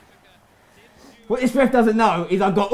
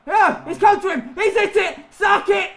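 high-pitched running commentary; urgent, high exclamations; excited gamer shouting; 9 semitones higher than usual. Real, not acted. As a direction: cry out as the chance comes. Excited play as chance comes.

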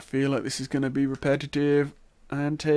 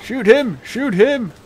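screaming high and loud; panicking gamer; 10 semitones higher, 11 dB louder, slower than usual. Real, not acted. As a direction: shout it in sheer panic. Panicked shouting during firefight.

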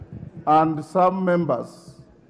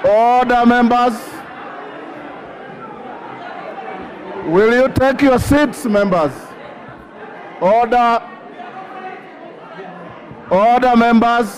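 raised, high, insistent calls over the noise; calling for order; 6 semitones higher than usual. Real, not acted. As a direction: keep hammering for order above the din. Speaker calling rowdy House to order.

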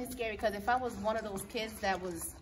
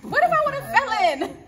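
mock horror; 12 dB louder than usual. Real, not acted. Recalling peering into deep well.

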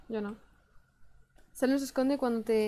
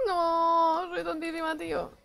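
much higher, mocking herself; high self-mocking; 6 semitones higher, slower than usual. Real, not acted. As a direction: tease yourself for being slow. Mocks herself after solving it. (burlándose de sí misma, aguda)

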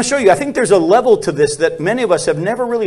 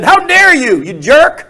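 sharp, loud, high mock outburst; angry retort; 8 semitones higher, 6 dB louder, slower than usual. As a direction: bark it at him like an offended man. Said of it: "Paul got mad and said".

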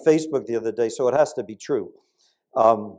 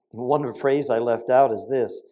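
ordinary talk, nothing marked

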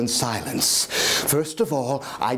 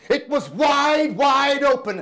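wide open, shouted higher; 13 semitones higher, 3 dB louder, slower than usual. Acted. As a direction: cry it out in horror. Horror at the open eye.

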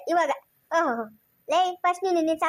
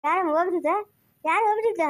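usual speaking voice

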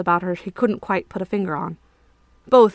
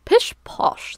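a light, airy pish posh; lightly voiced snooty reflection; dismissive reflection. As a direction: brush it off like a haughty nobleman. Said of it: "the reflection said".

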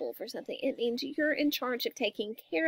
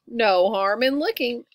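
lightly voiced father, slow and louder; papa bear; 5 dB louder, slower than usual. A narrator's reading. Papa agreeing to stop and look, slow voice. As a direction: say it slowly as an easygoing Papa.

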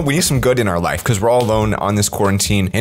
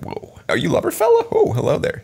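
put-on smitten girl. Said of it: "And she'll be like".

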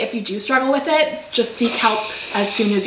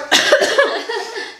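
throat cough; 13 semitones higher than usual. Real, not acted. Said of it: "do I sound like a frog in my throat".

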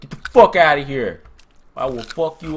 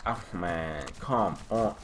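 usual speaking voice

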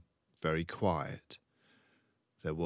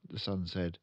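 plain reading voice

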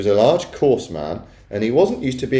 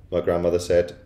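plain reading voice

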